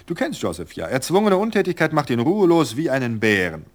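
a sprightly wife brushing off worry with a laugh; cheerful Virgilia. Acted. Virgilia about sick husband.

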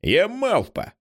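put-on high bratty child's yell; high teasing kid shout; defiant shout. A narrator's reading. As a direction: yell it to spite them. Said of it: "Дон його струшує і кричить".

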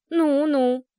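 impatient. Acted. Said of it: "нетерпеливо заёрзал Гнус".

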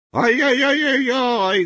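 high, slow gleeful wail; delighted squeal. Acted. Excited villager exclaims.

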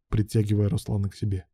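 ordinary delivery, nothing marked

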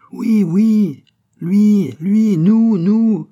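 very high, loud shouts; full-voiced, drawn-out cries; crowd crying out. A narrator's reading. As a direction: shout it like a jubilant crowd answering. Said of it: "crièrent vingt voix d'hommes".

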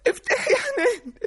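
high-pitched sobbing plea to be let in; distraught mother crying to her daughter; tearful pleading. Acted. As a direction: wail it through tears.

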